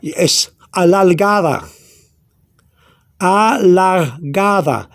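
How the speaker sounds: "Alargada" langsam und deutlich, Silbe für Silbe; slow Spanish demo. Shown as a demonstration. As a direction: sprich "Alargada" gedehnt vor, weiches D.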